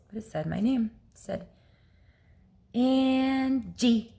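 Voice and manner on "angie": drawn out, higher, rising at the end; imitated ghostly voice. Unseen female voice calling a name in her ear, eerie drawn-out call. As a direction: call the name slowly, eerily stretched. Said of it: "just like that".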